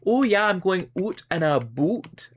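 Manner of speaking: mock Canadian "out and about" with oot and aboot; teasing Canadian cliché, lightly. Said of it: "stereotyped as saying".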